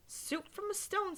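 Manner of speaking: put-on a touch of old woman wonder; old lady voice.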